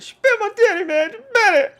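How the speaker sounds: high, loud mock bawl; hammed-up squealing complaint; wailing kid. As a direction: play a hurt child tattling at full wail. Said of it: "I said it just like that".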